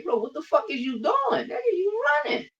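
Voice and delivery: high, crying-out yell in disbelief; exasperated yell. Real, not acted. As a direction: yell at him like he's running away. Angry rant at fighter.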